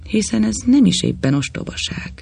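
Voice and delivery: plain reading voice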